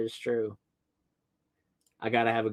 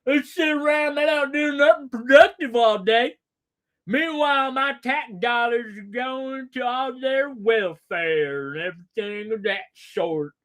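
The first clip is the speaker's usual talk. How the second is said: mock bigot; 6 semitones higher, 4 dB louder than usual. Streamer mocking a hateful guy ranting about immigrants. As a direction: sneer it like an angry bigot at home. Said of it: "sits around all day and goes".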